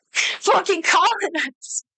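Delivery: slow, high put-on grumble; hammed-up mutter of outrage; mock grumble. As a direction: drag out a jokey curse at colonists. Jokey grumble after rant.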